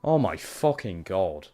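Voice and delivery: normal talking voice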